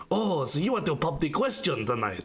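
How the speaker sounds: hammed-up fake French accent; terrible French accent, played for laughs; very bad French accent. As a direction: imitate a comically bad French waiter. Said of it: "a very bad French accent".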